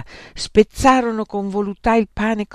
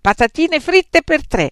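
high, loud order; raised, emphatic request; calling out an order; 10 semitones higher, 9 dB louder than usual. A narrator's reading. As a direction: shout the order to the waiter. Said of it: "chiamò Beatrice".

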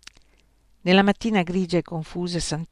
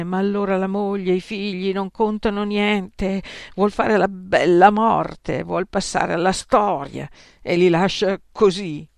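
rising, louder indignation; indignant outburst; 3 semitones higher, 5 dB louder than usual. A narrator's reading. Woman railing against Socrates to her friends, indignant. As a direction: scold the absent husband hotly. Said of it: "esclamò una delle donne".

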